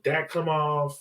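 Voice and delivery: usual speaking voice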